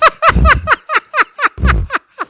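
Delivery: high, loud cackling fit; helpless laughter. Real, not acted. Host bursting out after insulting his friend's hairline, real laughing fit. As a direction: crack up uncontrollably.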